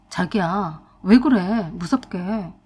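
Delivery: lightly voiced husband, dumbfounded and timid; a hint of a stunned husband asking what's wrong; dazed husband. Slapped husband confused at his wife, lightly voiced timid. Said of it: "멍청하게 그랬어요".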